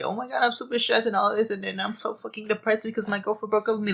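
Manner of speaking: high mocking whine of a weepy man; mock a weak man moaning about stress; whiny soft man. Mocking the men women don't want, in a high whine. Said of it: "cries all the time".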